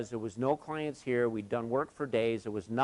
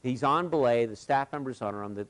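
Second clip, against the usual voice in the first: ordinary delivery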